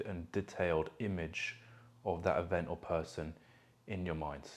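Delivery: soft, calm meditation voice with long pauses; guided meditation. Acted. Coach guiding listeners to feel emotions in their body, guided meditation. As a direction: guide them gently inward, leaving space between phrases.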